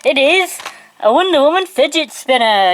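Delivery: high, bright announcing voice; excited reveal. Acted. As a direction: present it like a prize. Big reveal of the item.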